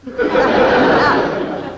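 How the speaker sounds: airy chuckling laugh; breathy laugh. Real, not acted. [name] laughing after her quarts joke. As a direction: laugh at your own punchline.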